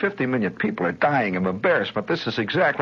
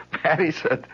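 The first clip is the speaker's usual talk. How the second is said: ordinary talk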